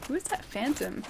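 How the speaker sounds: ordinary delivery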